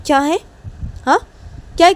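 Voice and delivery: high, loud retort; daughter snapping back. Acted. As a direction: bite back at mom. Tisha snaps back.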